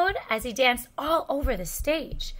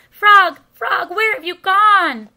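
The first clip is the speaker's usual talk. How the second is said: high, loud desperate call; crying out; 7 semitones higher, 12 dB louder than usual. A narrator's reading. Toad searches for vanished Frog, crying out. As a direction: call frantically for a lost friend. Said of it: "cried Toad".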